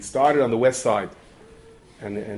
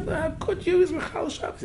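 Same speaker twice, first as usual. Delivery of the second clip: much higher voice, scandalised; outraged protest. Retold or imitated. Congregant protesting to the Rav about the smoker, outraged. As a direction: ask it aghast.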